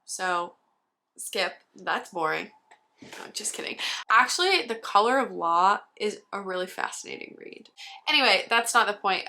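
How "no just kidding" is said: whisper. Real, not acted. Whispered aside about a boring shelf.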